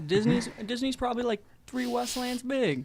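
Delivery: high-pitched put-on voice, slowing at the end; mock voice. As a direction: joke along in a silly high voice. Joins in the mock reading voice.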